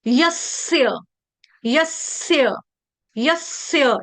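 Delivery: यस्य as yas-sya, s held long; correct joined consonant. Shown as a demonstration. Teacher saying look at me, held-s pronunciation. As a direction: linger on the half स in यस्य. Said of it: "इसमें मैं यसय नहीं पढ़ रही".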